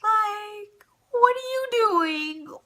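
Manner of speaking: high-pitched tearful wail, imitating herself; crying protest. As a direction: whine it out like a hurt kid betrayed by mom. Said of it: "I'm just crying".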